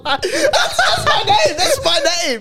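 high, laughing objection; laughing protest. Real, not acted. Laughing protest over his name.

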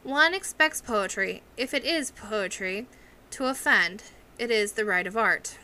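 ordinary delivery

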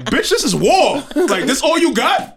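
high, loud war-ready outrage; mock outrage. Nephew reacting to his aunt's insult, high loud mock outrage. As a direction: hype yourself up for battle. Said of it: "I'm looking at my phone like".

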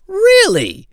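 high, incredulous "Really?"; mock surprise. Wedding guest hears his mom is the aunt, mock shocked squeak.

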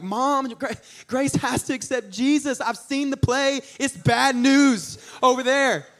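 put-on high, drawn-out child voice; urgent kid pleading. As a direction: plead with mom like a panicked little kid.

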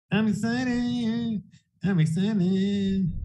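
slow, high, sing-song "I'm excited"; drawn-out excited. Shown as a demonstration. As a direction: draw it out high, savoring the hype. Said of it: "repeated line, plain then stretched".

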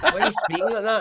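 high, loud question through laughter; laughing mock challenge. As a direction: imitate a baffled heckler while cracking up. Mimics fellow Scots challenging him, laughing.

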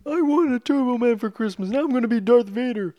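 goofy Arnold voice playing the scene; Arnold impression. Friend riffing on the movie in a hammed-up Arnold voice. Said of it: "That doesn't sound anything like, like Arnold".

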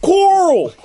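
high, loud drawn-out holler; mock shouted call. Podcaster parodying a TV character, mock shouted call. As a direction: call it out high, falling at the end.

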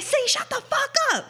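very high-pitched mock crowd yell; squeaky, exasperated quoted outburst; retold angry yell. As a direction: imitate everyone yelling her down. Crowd telling prankster to shut up.

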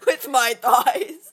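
slow, loud proud punchline. Pretend.

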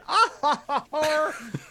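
big, high-pitched mock guffaw; imitated character laugh. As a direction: act out his drawn-out "ah ha ha" laugh. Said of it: "He did".

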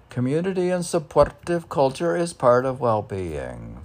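loud, declaiming poetry reading; poem reading. Acted. Reading his meditation poem aloud, declaiming it. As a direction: proclaim the verse to the room.

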